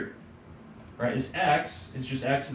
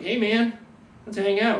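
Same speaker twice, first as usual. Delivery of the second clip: higher, louder buddy cat; friendly cat voice; 8 semitones higher, 6 dB louder than usual. Pretend. Teacher voices his cuddly cat, high and chummy. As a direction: voice a chummy cat who wants to hang. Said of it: "This guy is like".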